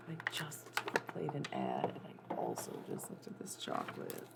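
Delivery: unvoiced hushed aside; whisper. Real, not acted. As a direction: whisper it to the mic. Whispered aside about chocolate.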